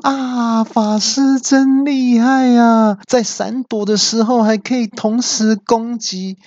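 high, stretched mock cheer; cheeky onlooker hollering praise; loud cheering. A narrator's reading. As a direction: taunt loudly from the sidelines. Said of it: "我就放声大叫说".

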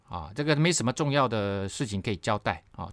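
ordinary delivery

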